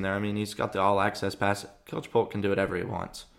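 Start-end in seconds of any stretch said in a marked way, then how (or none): none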